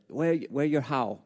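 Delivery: put-on Thai accent in broken English; heavy Thai accent. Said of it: "the heavy Thai accent".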